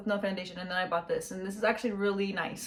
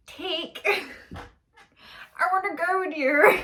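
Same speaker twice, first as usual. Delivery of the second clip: put-on a lightly done, higher child's accent, broken by laughter; bad Omega impression; 8 semitones higher, 3 dB louder, much slower than usual. Fan imitates Omega's accent from Bad Batch. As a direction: fumble a giggly imitation of the kid's accent. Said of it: "that was a really bad impersonation".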